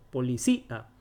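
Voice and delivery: "Policía" as poli-cí, then a lone a; hiatus separated. Shown as a demonstration. Teacher explaining the accent mark, split "Policía". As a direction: stress cí in "Policía" and detach the a. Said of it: "Se separa".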